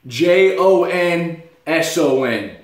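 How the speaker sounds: J O N S O N, each letter slow and spaced; spelled out slowly. Retold or imitated. Salesman confidently spelling the customer's name, slow letters. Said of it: "They expect me to spell it".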